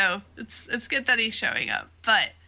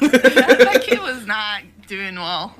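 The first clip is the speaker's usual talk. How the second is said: loud laughing correction; 11 dB louder, slower than usual. Real, not acted. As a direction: laugh through the words.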